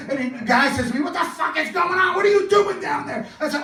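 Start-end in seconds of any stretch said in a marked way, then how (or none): 1.0-3.2 high, incredulous mock outburst; exasperated demand. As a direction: demand to know what's going on. Startled man confronts him mid-scene.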